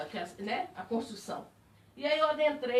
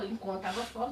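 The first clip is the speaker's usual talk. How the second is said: ordinary delivery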